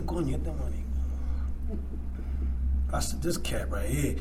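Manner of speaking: low, soft grumbling aside; muttered aside. Retold or imitated. To himself about his sponsor, muttered low. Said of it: "Y yo le dije".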